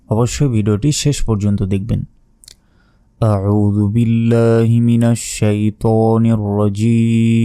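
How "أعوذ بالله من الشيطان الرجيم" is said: slow, measured Quranic recitation. Acted. Teacher opens the lesson with slow recitation. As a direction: recite it slowly and reverently.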